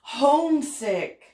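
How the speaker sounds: slow drawled Southern homesick; southern accent. Acted. Reader to kids, a light Southern accent. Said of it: "get out my southern accent".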